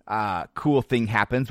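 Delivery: ordinary delivery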